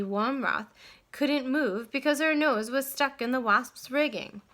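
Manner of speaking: ordinary delivery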